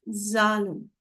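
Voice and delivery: ज़ालन said slowly with a clear z; teacher modelling ज़ालन; German zehn. Shown as a demonstration. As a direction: spell it out then say ज़ालन carefully. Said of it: "Z H A L E N".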